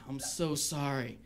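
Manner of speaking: soft, slow bad-news doctor voice; sombre doctor. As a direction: break the bad news gently, in slow motion. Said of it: "and the doctor would be like".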